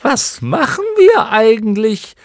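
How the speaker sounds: high, loud creature voice; wondering clown moth. A narrator's reading. Another moth wants to know, wondering loudly. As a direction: shout the question like a silly creature. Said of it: "wollte eine andere wissen".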